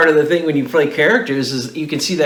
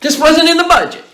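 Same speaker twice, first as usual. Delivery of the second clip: mock excuse; 9 semitones higher, 4 dB louder than usual. Joking excuse about the budget.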